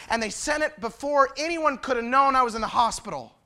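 loud, high, fervent preaching; full-force preaching. Acted. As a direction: preach it out with fire.

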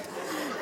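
a quick airy chuckle; short breathy laugh. Real, not acted. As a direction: laugh under your breath. Laughing about the sweet tea.

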